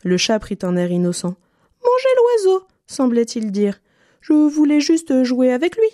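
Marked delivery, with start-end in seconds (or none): 1.8-2.7 high cat voice; sly cat. A narrator's reading. Cat pretending innocence to Pierre, high sly voice. 4.2-5.9 high sugary feigned-innocent cat. A narrator's reading. Cat excusing himself to Pierre, high mock innocence.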